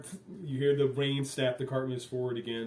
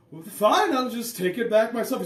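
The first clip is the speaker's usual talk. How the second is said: higher, louder huffy bluff; woman bluffing; 9 semitones higher, 6 dB louder than usual. Acted. Woman bluffs to the guards, higher, mock huffy. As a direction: pretend to take the food away. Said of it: "she said".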